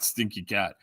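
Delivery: ordinary talk, nothing marked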